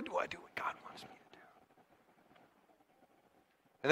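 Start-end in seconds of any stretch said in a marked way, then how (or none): 0.0-1.4 fast breathy whisper to himself. Acted. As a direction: hush it like a private doubt. Preacher whispers inner question.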